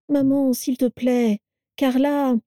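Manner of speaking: high, slowed entreaty; pleading son. A narrator's reading. Edgar begs his mother to be kinder, pleading.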